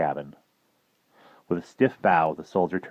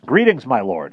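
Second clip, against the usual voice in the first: raised, formal monk greeting; professor voice; 6 semitones higher, 7 dB louder than usual. Acted. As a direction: speak up as the earnest professor. Professor character voice.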